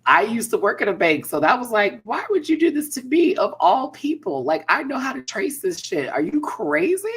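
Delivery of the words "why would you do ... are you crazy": high, loud incredulous rant; indignant, can't believe it; outraged disbelief. Retold or imitated. Retold outraged reaction at bank.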